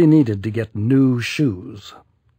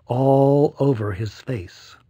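plain reading voice

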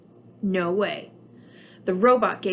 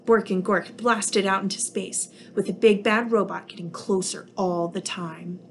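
ordinary delivery, nothing marked

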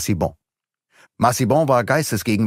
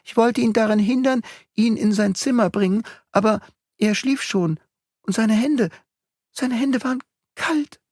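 frightened nursemaid; 13 semitones higher than usual. A narrator's reading.

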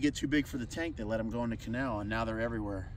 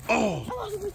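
surprised cry. Real, not acted. Fish suddenly gets off the line.

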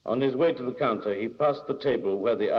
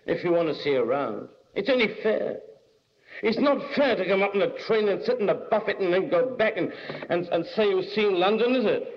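insistent man in buffet; 10 semitones higher than usual. A narrator's reading. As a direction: press the point, voice pitched up. Same man keeps arguing.

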